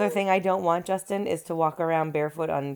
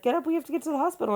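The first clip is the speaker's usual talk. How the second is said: imitating husband; 9 semitones higher, much faster than usual. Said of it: "You'd be like".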